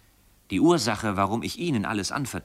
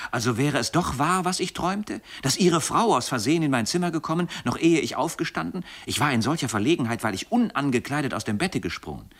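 flustered confusion; 6 semitones higher than usual. A narrator's reading. Young man to his tutor about the wife in his room, flustered and higher. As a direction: pitch it up in flustered disbelief. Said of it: "rief ich ganz verwirrt".

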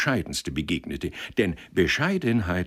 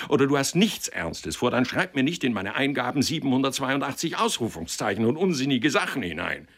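higher, forceful telling-off; stern lecture; 3 semitones higher than usual. Acted. Lawyer ordering the lovesick clerk to end it, stern. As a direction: lay down the law.

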